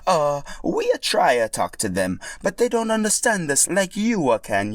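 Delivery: sheepish Italian plumber voice; Mario voice. Acted. Mario admitting he can't talk to Yoshis, Italian accent.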